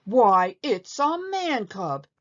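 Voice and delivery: loud gasp, then high, slow disbelief; gasping in shock. A narrator's reading. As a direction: reel back at the sight of the man cub. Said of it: "he gasped".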